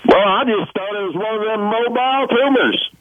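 a light, retold Ozark country accent. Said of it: "her best Ozark accent".

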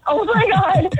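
laughing through the words; real laughing relief. Real, not acted. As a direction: giggle it out in relief. Laughing at prank reveal.

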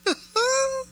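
a bright burst of real laughter; amused laugh. Real, not acted. Host laughing at the clip, an amused laugh.

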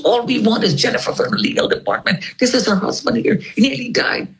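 a retold urgent plea to be let through; retold noisy demand. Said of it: "Albert is making so much noise".

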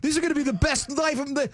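high, giddy, imagining endless bliss; bubbling over with newlywed glee. Retold or imitated. Husband recalling honeymoon hopes, giddy and high. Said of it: "I'm like".

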